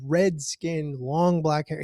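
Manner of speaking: normal talking voice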